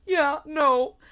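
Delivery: high, loud mock wail; mock sobbing denial. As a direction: imitate a tearful girlfriend's denial.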